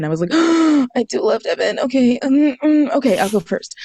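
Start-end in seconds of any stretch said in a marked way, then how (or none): 0.4-0.9 sharp, high gasp of playful shock; mock gasp. Friend teases her about Devin, she gasps in mock shock. 1.0-3.7 higher, a little breathless, caving in; fluster, then agree to go first; flustered giving in. Retold or imitated. Caving to her friend's teasing, higher and flustered.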